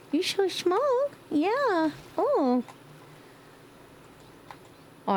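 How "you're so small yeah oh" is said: high squeaky coo. Real, not acted. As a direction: squeal over how small it is. Cooing at tiny baby.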